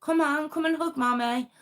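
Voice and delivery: high sing-song coaxing mother; mother coaxing her son to hug her; coaxing mum. Retold or imitated. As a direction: coax the little boy, high and sweet. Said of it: "And all she's there going".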